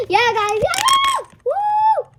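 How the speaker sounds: put-on high, loud squealing kids cheering; rowdy classmates. Imitating the whole class, squealing loud and high. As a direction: ham up a wild class yelling and whooping. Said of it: "That's how the whole class sounded".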